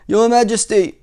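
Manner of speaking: loud, high formal proclamation; ringing storybook soldier's address; soldier addressing king. Acted. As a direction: hail the king like a soldier reporting. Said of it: "said the soldier".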